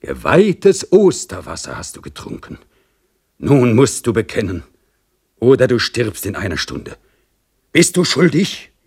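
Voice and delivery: solemn priest threatening death; triumphant priest. Acted. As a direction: pronounce the sentence like a judgment. Priest's triumphant final demand.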